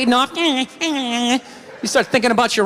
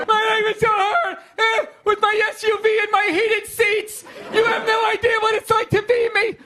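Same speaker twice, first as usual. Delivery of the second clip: high, whining mock complaint; 8 semitones higher than usual. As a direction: play the spoiled complainer, pitched up.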